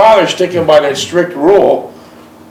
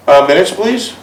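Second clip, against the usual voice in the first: normal talking voice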